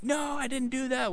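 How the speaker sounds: high-pitched mock protest; protesting denial. Teen denying his ex-girlfriend's accusation, high mock protest. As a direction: pitch it way up in defensive imitation.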